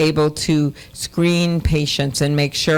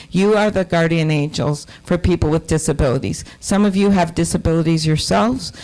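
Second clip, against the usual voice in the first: usual speaking voice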